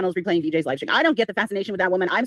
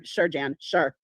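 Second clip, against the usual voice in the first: sarcastic; 7 semitones lower, 5 dB quieter, much slower than usual. Real, not acted. Sarcastic dismissal of excuse.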